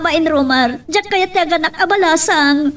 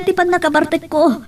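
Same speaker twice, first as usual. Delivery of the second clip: ordinary delivery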